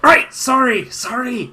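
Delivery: high, hurried-back-to-duty sorry; startled mock apology; flustered apology. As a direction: blurt it high, caught out. Guard punched for talking on duty.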